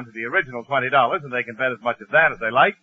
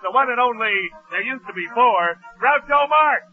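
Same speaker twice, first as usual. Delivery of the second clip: higher, ringing showman's announcement; radio announcer intro; 9 semitones higher than usual. Acted. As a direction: sell the star's entrance to the crowd. Show opening announcement.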